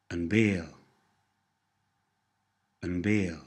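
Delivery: bale starting with a B, not a P; soft B in bale. Shown as a demonstration. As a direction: model the softened B in bale. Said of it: "The P sound softens to a B sound".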